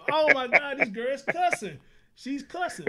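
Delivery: high, loud amused disbelief; loud, high-pitched astonishment; shocked parent. Retold or imitated. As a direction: retell your shock at a cussing baby. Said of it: "I'm like".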